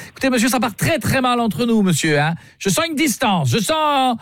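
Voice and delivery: hammed-up snooty client lecturing the hotel clerk; mock-offended caller. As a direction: scold him like a snooty know-it-all.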